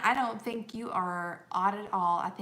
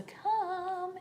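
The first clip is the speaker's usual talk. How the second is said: drawn-out, higher sing-song delight; excited; 10 semitones higher, 3 dB quieter than usual. Real, not acted. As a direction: stretch it out in happy anticipation. Delighted that guests may come.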